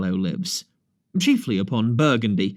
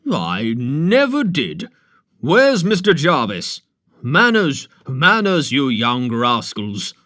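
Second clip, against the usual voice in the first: put-on louder, stern country doctor telling off boys; scolding doctor; 4 dB louder, slower than usual. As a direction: bark manners at the rascals.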